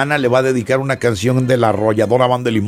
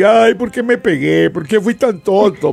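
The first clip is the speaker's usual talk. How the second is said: mock whining; 7 semitones higher than usual. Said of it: "Ay, me dolió".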